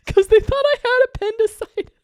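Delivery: high, giggling while talking; can't stop laughing at the memory; laughing through it. Real, not acted. Laughing as she starts the kidney story.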